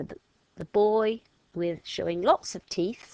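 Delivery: ordinary delivery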